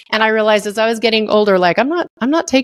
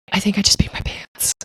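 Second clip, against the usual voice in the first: whisper; 5 dB quieter than usual. Retold or imitated. Terrified reply to God's voice.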